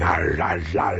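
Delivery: low munching "arr, arr"; noisy bear-like chomping sound; growly eating noise. A narrator's reading. Storyteller imitating Bear chewing away on his food, growly eating noise. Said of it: "Bear's eating".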